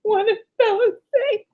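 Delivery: high squeal of laughter through the words; laughing so hard the voice jumps up. Real, not acted. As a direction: crack up while asking. Laughing retelling.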